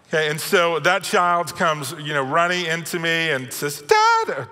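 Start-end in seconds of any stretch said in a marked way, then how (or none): 3.8-4.5 put-on a squeaky, far-too-high child's "Dad"; too-high kid voice. Hurt son calls to dad, in a squeaky, too-high voice. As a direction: overshoot the kid's "Dad" way up high. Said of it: "no, not that high".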